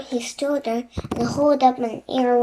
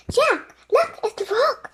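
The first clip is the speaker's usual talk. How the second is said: excited; 12 semitones higher than usual. Acted. Annie excitedly pointing something out.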